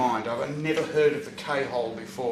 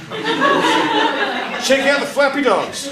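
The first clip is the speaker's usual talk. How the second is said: high, slow, laughing wonder; giddy drugged delight; 11 semitones higher, 7 dB louder, much slower than usual. Retold or imitated. To the nurses on the ward, high laughing glee. Drugged patient sees flappy dogs.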